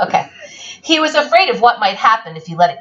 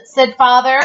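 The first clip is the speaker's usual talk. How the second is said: ordinary delivery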